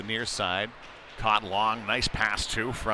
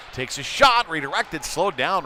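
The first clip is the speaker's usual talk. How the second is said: much higher and louder burst; excited shot call; 5 semitones higher, 5 dB louder than usual. Real, not acted. Announcer calling a sudden shot on goal, sharp and loud. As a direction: punch out the shot like it might go in.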